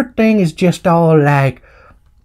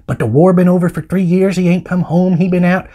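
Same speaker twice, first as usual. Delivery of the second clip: normal talking voice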